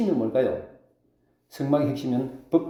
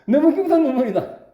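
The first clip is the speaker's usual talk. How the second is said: high-pitched, louder cry of joy; overjoyed; 12 semitones higher, 6 dB louder than usual. Acted. Preacher acting out someone crying from happiness, overjoyed. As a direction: act out being moved to tears by happiness. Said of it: "너무 기뻐도 울어요".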